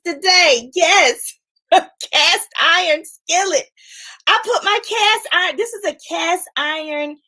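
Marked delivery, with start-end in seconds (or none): none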